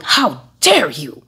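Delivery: slow, mock-haughty rich lady; offended rich lady. Preacher imitates rich ladies taking offence, slow mock-haughty. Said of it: "rich ladies going".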